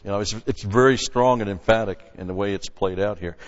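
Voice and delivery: ordinary talk, nothing marked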